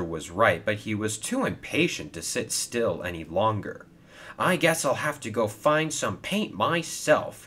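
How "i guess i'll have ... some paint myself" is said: mildly bold, voice lifted; understated bold resolve. Acted. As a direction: declare it with a touch of boldness. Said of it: "he said boldly".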